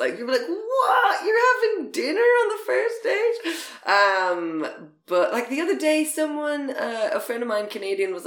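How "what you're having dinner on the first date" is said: much higher, laughing shock; mock disbelief. Irish friend hearing about dinner dates, mock disbelief. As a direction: squeal of mock horror. Incredulous acted quote.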